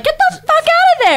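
very high, put-on yell at a rude viewer; mock yell. Woman yelling at a rude moviegoer, mock yell. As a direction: bellow it standing in the theater. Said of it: "I'm the lady that stands up and is like".

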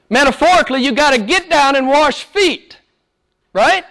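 fiery pulpit preaching; preaching at full force. Acted. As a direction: preach it hard to the congregation.

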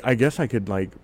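normal talking voice

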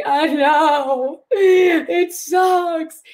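high, loud, crying whine; whiny complaint. Retold or imitated. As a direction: moan it like your heart's breaking. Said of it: "it was this, like, complaint".

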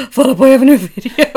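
loud excited squeal with a laugh; excited drunk gasp. Retold or imitated. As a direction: shout it with drunk glee. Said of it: "and I was like".

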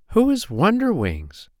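put-on high-pitched childlike question; curious little fairy. A narrator's reading. Poppy Pink asking who is coming, high and curious. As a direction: ask high, falling at the end.

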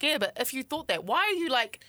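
high-pitched, indignant challenge; a little upset. Retold or imitated. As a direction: press him, mildly upset. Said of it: "got a little bit upset".

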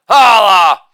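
loud exclaimed tag; big showy holler; shouted catchphrase. Acted. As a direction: belt it out as a punchline button. Shouted catchphrase after each bit.

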